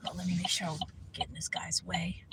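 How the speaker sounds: a whispered aside while stepping aside; quick whisper. Real, not acted. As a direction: drop to a whisper to stay out of his way. Aside while passing someone outside.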